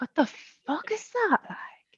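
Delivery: high, drawn-out, hushed bafflement; baffled disbelief. Acted. Southerner reacting to unintelligible Belfast Irish, acted bafflement. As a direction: stretch it out, soft and high, mock-bewildered.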